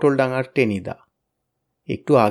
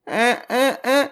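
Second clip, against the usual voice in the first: dismayed stammer; 10 semitones higher than usual. A narrator's reading.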